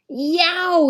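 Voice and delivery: sharp high yelp of pain; a sudden high pained yow; cry of pain. A narrator's reading. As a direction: yelp as your palm burns. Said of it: "he cried in pain".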